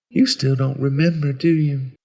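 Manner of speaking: put-on high child voice, mildly bitter; understated bitter little boy; cold and bitter. A narrator's reading. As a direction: accuse with a touch of cold resentment. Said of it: "His voice was cold when he said this".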